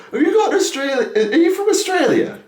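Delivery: high-pitched impression of pupils asking; squeaky mock schoolkid questioning the teacher; curious kids. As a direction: pipe up like an inquisitive child. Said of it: "I literally had kids like".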